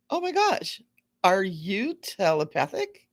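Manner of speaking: amazed gasp. Retold or imitated. Amazed at godson's telepathy.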